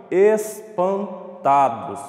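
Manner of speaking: "espantados" high and very slow, syllables parted; sílaba por sílaba. Shown as a demonstration. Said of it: "Já fiz a separação aqui, ó, só em falar". ("espantados" agudo e devagar, em sílabas)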